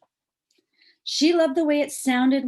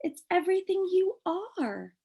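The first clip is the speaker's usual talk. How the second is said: tag; 10 semitones higher than usual. Acted. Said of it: "said her mother".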